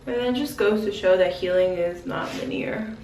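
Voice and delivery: ordinary talk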